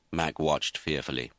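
ordinary delivery

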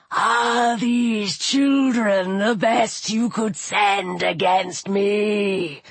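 slow, drawn-out low villain voice; serpent god's scorn. A narrator's reading. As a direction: sneer slowly as the serpent. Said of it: "Apophis spoke from an ivory shapti".

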